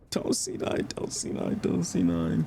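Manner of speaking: mock scream under the breath; quiet, half-whispered imitation of a callout; retold scream, hushed. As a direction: softly mimic a repeated plea. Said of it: "He was screaming".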